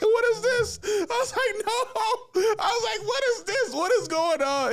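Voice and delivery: very high, loud, squealing panic; panicked disbelief. Retold or imitated. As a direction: squeal at your own baby fever. Shocked inner voice, baby fever.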